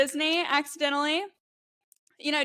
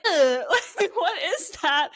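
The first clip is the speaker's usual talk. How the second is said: disgusted laughing; 5 semitones higher, 4 dB louder than usual. Retold or imitated. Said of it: "and I was like".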